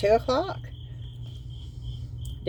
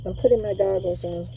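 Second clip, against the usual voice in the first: talking through a respirator mask; muffled behind a mask; 7 dB quieter than usual. Real, not acted. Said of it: "in this mask".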